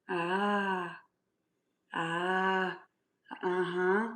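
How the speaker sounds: usual speaking voice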